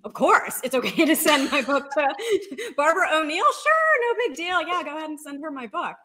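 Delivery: playing it cool with a high, loud, laughing voice; mock nonchalance. Author retelling her reply to the editor, hammed-up casual excitement. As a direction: pretend it's nothing while bursting with excitement. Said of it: "I said".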